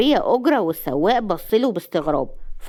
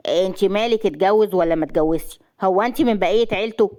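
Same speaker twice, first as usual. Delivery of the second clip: ordinary delivery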